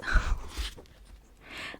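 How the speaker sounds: real whispered laugh at a slip; hushed burst of laughter; breathy laugh. Real, not acted. Laughs at own reading slip.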